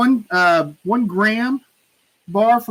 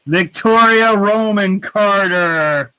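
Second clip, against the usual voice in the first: slow, drawn-out announcer call; winner announcement; 4 dB louder, slower than usual. Real, not acted. Host revealing the giveaway winner, drawn-out announcer call. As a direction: stretch the winner's name out big and loud.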